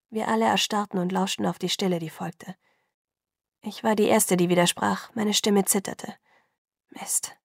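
plain reading voice

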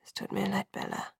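faint muttered apology; barely audible murmur. A narrator's reading. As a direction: breathe it out under your breath. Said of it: "ein kaum hörbares Gemurmel".

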